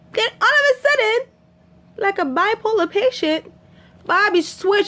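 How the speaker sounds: high excited narration. Real, not acted. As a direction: build the surprise. Excited retelling twist.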